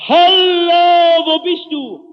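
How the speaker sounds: loud, high, drawn-out hallo; searchers' distant call. Retold or imitated. Searchers calling out to a lost walker, long high shout. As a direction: call far across the open prairie. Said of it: "auf einmal höre ich so merkwürdige Laute".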